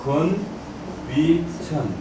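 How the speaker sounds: very slow, separated syllables. Shown as a demonstration. Teacher answering how the hexagram is read, syllable by syllable. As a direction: draw out each syllable of the reading. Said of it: "이걸 뭐라 한다고요?".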